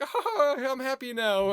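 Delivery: squeaky, hammed-up happy tech guru; frail voice. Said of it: "really frail talking voice".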